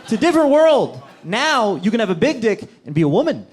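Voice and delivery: ordinary delivery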